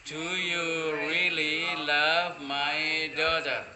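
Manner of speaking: loud, drawn-out classroom English; slow, projected example for learners; slow model sentence. Shown as a demonstration. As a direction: stretch every word out almost like chanting.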